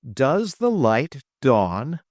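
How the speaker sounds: slow, drawn-out teasing question; slow, knowing. Acted. Travis teases Trip and Dollinger, slowly. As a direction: let it sink in slowly.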